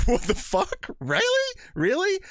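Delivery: high, incredulous squeals of disbelief; shocked disbelief. Retold or imitated. Ben hearing the scoop, high shocked disbelief. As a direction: repeat it like you can't believe the news. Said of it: "Ben's like".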